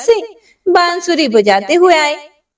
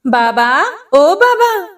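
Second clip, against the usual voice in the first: calling out; 4 semitones higher, slower than usual. A narrator's reading. Hunter calls the saint at the hut door, calling out. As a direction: call to the saint, rising higher on the second call. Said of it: "शिकारी ने आवाज लगाई".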